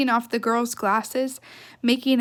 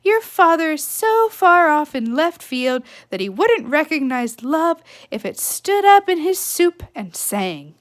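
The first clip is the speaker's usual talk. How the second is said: higher, louder scornful mother; mother's voice; 5 semitones higher, 7 dB louder than usual. Acted. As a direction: sneer at the ex-husband loudly. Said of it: "her mother had said".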